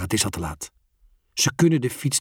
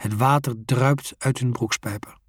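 usual speaking voice

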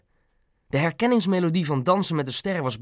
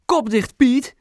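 high, louder retort to a husband; snapping back; 10 semitones higher, 5 dB louder, slower than usual. A narrator's reading. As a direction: shut him up sharply. Wife snaps back at shouting husband. (fel terugsnauwen)

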